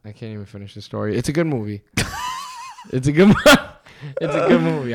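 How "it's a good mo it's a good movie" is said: laughing. Real, not acted. Laughing at own story.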